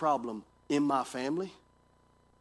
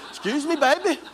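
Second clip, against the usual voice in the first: higher, louder, laughing through the words; wife's line, retold again with a laugh; 9 semitones higher, 3 dB louder than usual. Pastor retells his wife startling him in the dark, higher and laughing. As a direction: replay his wife's polite line, cracking up. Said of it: "That's what she says".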